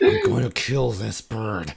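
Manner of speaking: hammed-up fed-up outburst, stretched out; mock exasperation. Sleepless houseguest fed up with a noisy bird, mock exasperated. Retelling being kept awake by bird.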